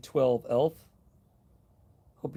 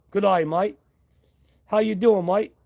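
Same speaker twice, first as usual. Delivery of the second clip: hammed-up Aussie accent, pitched higher; butchered Australian accent; 6 semitones higher, 5 dB louder than usual. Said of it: "I'm totally butchering your accent".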